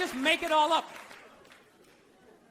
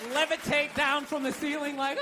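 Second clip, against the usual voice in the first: ordinary talk, nothing marked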